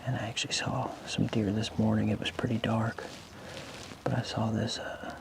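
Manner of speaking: soft morning hunting whisper; hushed report from the stand; wildlife watching whisper. Real, not acted.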